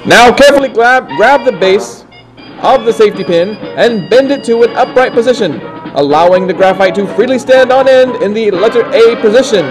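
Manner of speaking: normal talking voice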